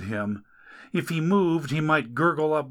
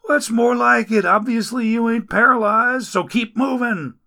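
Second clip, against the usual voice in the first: louder, higher griping rescuer voice; grumbling rescuer; 10 semitones higher, 6 dB louder than usual. Acted. Rescuer complains over injured Dagon, loud grumbling. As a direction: grouse while getting him up.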